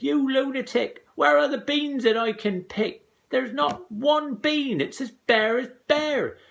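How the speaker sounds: high, loud shrill mother; mother scolding up high; shrill angry mother. A narrator's reading. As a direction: screech at the silly boy.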